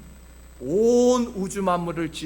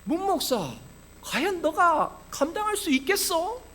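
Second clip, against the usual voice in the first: imitated taunt, pitched high; mocking Satan; 10 semitones higher than usual. Satan taunting the pastor over the debt, mock jeering. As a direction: needle the pastor with a mocking question. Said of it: "사탄이 그렇게 저를 비웃는 것만 같았어요".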